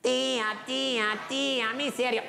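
put-on high singsong children's taunt; taunting brats. Brats mock the old woman from her tree, taunting singsong. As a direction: chant it high, like teasing kids. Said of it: "And then they go".

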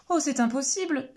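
panicked badger. Badger panics when Turtle asks for a kiss. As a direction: flap about like a startled badger. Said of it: "s'affole Blaireau".

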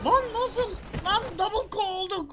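shocked gamer outcry; stunned shout. Real, not acted. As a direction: shout in utter disbelief. Both players knocked out at once.

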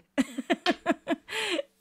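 high giggling burst of laughter; real high-pitched giggle; self-conscious laugh. Real, not acted. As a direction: laugh at yourself in a high, quick run. Laughs at her own weird voice.

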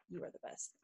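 breathy unvoiced whisper of "you are the best"; soft whispered praise. Real, not acted. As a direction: whisper it like a secret compliment. Whispered shout-out to a listener.

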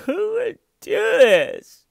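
high, loud, slow whiny wail; mock fake crying. Vlogger mocking suspect's fake interview tears, high drawn-out whine. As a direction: ham up the phony sob. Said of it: "sitting there going".

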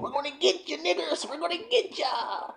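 high, soft mocking taunt; mock menacing taunt; taunting pursuers. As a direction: tease like cocky chasers.

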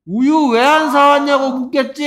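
very high, very loud outburst; exasperated shout. Real, not acted. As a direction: shout the obvious answer in frustration. Teacher bursts out with correct answer. (답답해서 버럭 지르는 고함)

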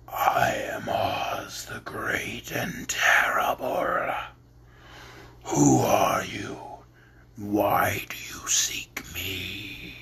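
slow, low, whispered ball of fire; low quiet voice. Acted. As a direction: speak from the fire in a low hush. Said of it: "low, quiet voice".

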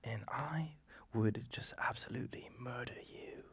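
whispered English threat; hushed, menacing English villain; menacing English accent. Shown as a demonstration. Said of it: "English would kinda be like this".

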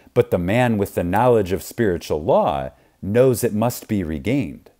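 normal talking voice

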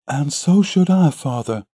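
put-on high-pitched, spirited young woman; spirited daughter. A narrator's reading. Daughter agrees with her father, spirited. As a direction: answer readily, voice lifted high. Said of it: "she answered with spirit".

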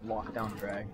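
ordinary talk, nothing marked

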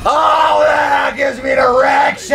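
high-pitched, drawn-out comic yell; hammed-up full-throated holler; over-the-top shouting. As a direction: scream it out in mock ecstasy. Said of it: "I'm sorry about shouting".

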